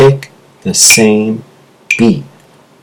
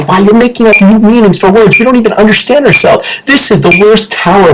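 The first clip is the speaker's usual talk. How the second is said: heated rant; 9 semitones higher than usual. Real, not acted. Ranting about made-up word meanings.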